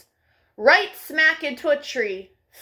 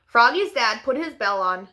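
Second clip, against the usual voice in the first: ordinary delivery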